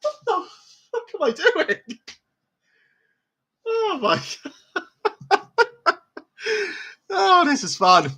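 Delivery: helpless, high-pitched laughing speech; laughing at your own silliness, voice way up high; cracking up. Real, not acted. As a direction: squeal it through laughter.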